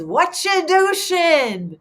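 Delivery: slow, high playful catchphrase; drawn out like his cheerful friend; friend's catchphrase. Retold or imitated. Quoting a late best friend's happy catchphrase, slow and high. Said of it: "one of David's favorite sayings".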